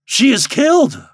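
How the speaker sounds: high, loud outcry of alarm; horrified cry. A narrator's reading. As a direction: cry out as if witnessing a death. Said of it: "cried the others".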